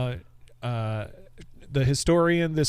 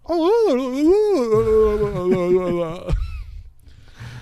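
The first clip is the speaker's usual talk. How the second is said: high, loud warbling wail, sliding down; long cartoon swoon noise, wobbling downward; imitated goofy wail; 7 semitones higher, 6 dB louder than usual. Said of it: "he didn't say".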